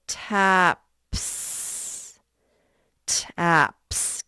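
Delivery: "taps" stretched, with a long hissed s on the end; sounded-out final S. Shown as a demonstration. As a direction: say "taps" slowly, holding the final s apart. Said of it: "put a S sound at the end".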